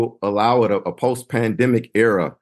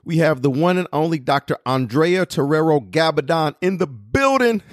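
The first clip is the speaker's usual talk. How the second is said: higher-pitched, excited host introduction; big hype build to a raised finish; hyped welcome; 6 semitones higher than usual. Real, not acted. Host presenting the one and only guest, hyped.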